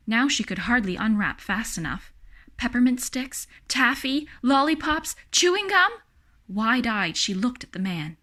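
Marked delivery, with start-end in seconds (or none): 2.6-5.9 each candy higher and louder; building excitement. A narrator's reading. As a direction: climb with delight item by item. Lucy unwrapping candies faster.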